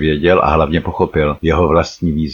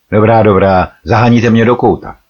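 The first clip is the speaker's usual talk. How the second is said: cornered man's loud, higher-pitched outburst; exclaiming loudly; 5 semitones higher, 7 dB louder than usual. A narrator's reading. Hilek protests at being driven into a corner, exclaiming loudly. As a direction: cry out, raised in pitch and volume. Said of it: "rozkřikl se Hilek".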